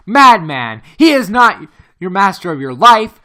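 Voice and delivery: loud and high, pleading urgency; desperate outburst. A narrator's reading. Axel urging Hans to flee, loud desperate cry. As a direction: shout him into moving.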